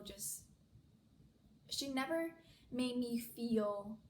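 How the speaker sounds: ordinary talk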